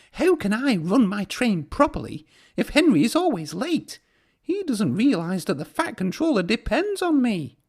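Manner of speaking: voiced high, cross and huffy; cross little engine. A narrator's reading. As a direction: grumble like an impatient engine. Thomas complains crossly.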